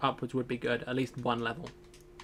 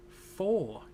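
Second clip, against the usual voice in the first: shocked; 6 semitones higher than usual. Real, not acted. Shocked question.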